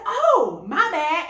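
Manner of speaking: high, loud oh, my bad; startled apology. To the waiting girls, sudden high loud apology. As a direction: blurt the apology, caught off guard. Caught hogging the bathroom.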